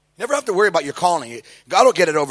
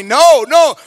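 urgent no; 9 semitones higher, 11 dB louder than usual. Retold or imitated. Guy warning him, voiced.

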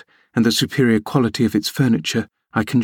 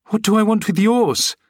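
much higher, sharp indignant retort; 10 semitones higher than usual. A narrator's reading. Guest retorting at the servant, indignant and high. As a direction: snap back in outrage. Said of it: "I retorted".

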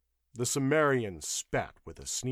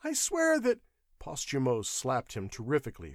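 ordinary delivery

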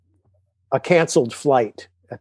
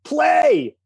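urgent yell. Retold or imitated. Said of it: "Paul Shaver's going".

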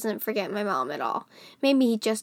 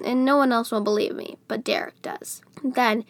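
usual speaking voice

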